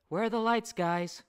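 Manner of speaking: lightly voiced Sarah. A narrator's reading.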